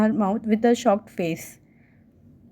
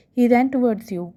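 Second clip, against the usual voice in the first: ordinary delivery, nothing marked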